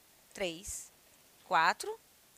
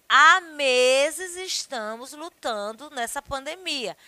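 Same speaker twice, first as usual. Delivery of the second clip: slow, loud example; 3 semitones higher, 7 dB louder, slower than usual. Shown as a demonstration. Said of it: "eu posso dizer assim".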